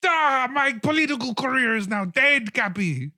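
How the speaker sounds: high, loud frantic scolding; stomping mayor berating Cappy; furious mayor. Acted. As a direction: rant at him like a ruined politician. Mayor ranting.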